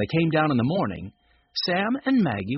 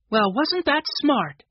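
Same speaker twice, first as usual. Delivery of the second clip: put-on high, slow girl's voice; lightly voiced impressed girl; admiring girl; 10 semitones higher, 4 dB louder than usual. A narrator's reading. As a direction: marvel in a higher-pitched voice.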